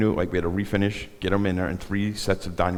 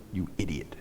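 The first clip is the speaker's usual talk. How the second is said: breathy whispered jab at the end; half-joking hushed insult; 2 semitones lower, 12 dB quieter than usual. Retold or imitated.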